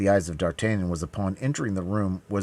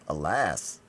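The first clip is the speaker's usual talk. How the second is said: ordinary delivery, nothing marked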